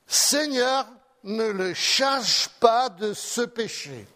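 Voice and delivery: Seigneur called out high and loud, then slow; loud cry. Acted. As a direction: cry out to the Lord as Stephen. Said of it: "d'une voix forte". (Étienne à genoux qui s'écrie d'une voix forte, lentement)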